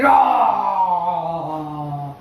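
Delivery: low, long roaring shout; lion roar. Shown as a demonstration. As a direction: exhale into a big lion roar. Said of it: "Inhale and exhale".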